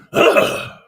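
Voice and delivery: a loud, breathy groan of frustration; frustrated groan. Real, not acted. Streamer annoyed at forgetting a name, loud frustrated groan. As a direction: let out a fed-up ugh.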